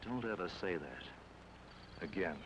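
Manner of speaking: low, soft and slow warning; quiet threat. Acted. He warns the lady quietly and slowly never to repeat it. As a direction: warn her coldly, slow and quiet. Cold warning after being called crazy.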